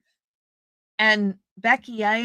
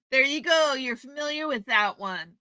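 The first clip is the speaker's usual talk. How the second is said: ordinary delivery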